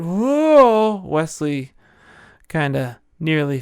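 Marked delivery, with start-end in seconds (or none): 0.0-1.0 loud startled whoa. Acted. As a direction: cry out as you topple. Wesley nearly faints.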